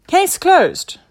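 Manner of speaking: loud, high declaration; case-cracked proclamation; triumphant sidekick. A narrator's reading. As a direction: announce it with finality.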